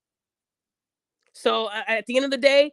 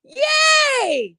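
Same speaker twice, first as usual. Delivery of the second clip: much higher, louder squeal of delight; excited kid; 12 semitones higher, 5 dB louder than usual. Retold or imitated. Imitating a kid getting a new toy, high squealing delight. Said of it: "seen a kid when you give 'em a new toy".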